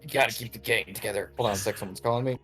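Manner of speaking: put-on deep older brother. Older brother's last words.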